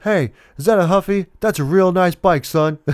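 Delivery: high-pitched imitation of a chummy father; jovial dad voice. Dad remarking on his son's Huffy, jovial put-on voice. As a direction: call out admiringly, playfully put on. Retold father admiring the bike.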